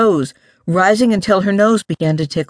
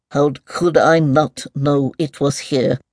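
distracted, mumbling mole; absent-minded soliloquy, lightly voiced; talking to itself. A narrator's reading. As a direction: mutter it as a private grumble. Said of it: "seemed to be talking to itself".

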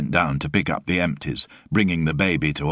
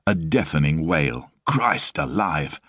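usual speaking voice

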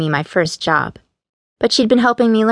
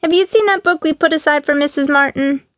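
raised, higher-pitched call across a shop; 10 semitones higher than usual. A narrator's reading. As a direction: call out a question to someone out of sight. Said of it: "called out Beatrice from the front".

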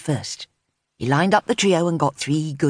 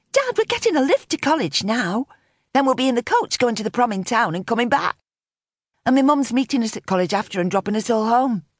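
put-on higher-pitched young voice, explaining; protesting teenage girl; 7 semitones higher than usual. A narrator's reading. Teen explains lift plans to dad, protesting.

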